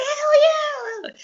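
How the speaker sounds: hammed-up approval; mock enthusiastic yes. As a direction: cheer it high like a hyped boss. Boss voiced approving.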